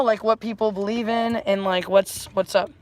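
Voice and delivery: normal talking voice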